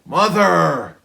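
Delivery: put-on teen fed up with her nagging mother, loud exasperation; exasperated outburst. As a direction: snap at her mother in frustration. Exasperated girl cuts mother off.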